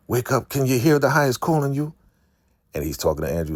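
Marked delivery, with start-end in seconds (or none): 0.1-1.9 much higher, insistent rousing voice; acted wake-up call. Captors calling to an unconscious woman, high acted summons. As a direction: summon her awake in a raised pitch.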